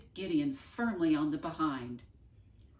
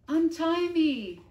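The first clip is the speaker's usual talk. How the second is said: high, loud, slow call; 12 semitones higher, 13 dB louder, slower than usual. Acted. Eddie calling across the clearing to Lionel, high and loud. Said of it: "Eddie called to Lionel".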